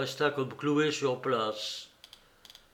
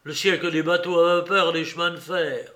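put-on raised, defiant old father; Fiodor Pavlovitch; 3 semitones higher, 6 dB louder than usual. A narrator's reading. Old father scoffing at the monks, defiant. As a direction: jeer at the monks, louder on the kopecks.

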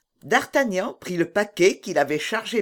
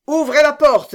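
high, loud command to the guard; sergeant's raised-voice command; called-out order; 10 semitones higher, 9 dB louder than usual. A narrator's reading. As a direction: call out the order to open up. Sergeant orders the gate opened.

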